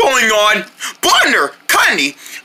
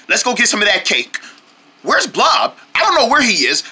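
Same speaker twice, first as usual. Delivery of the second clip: ordinary delivery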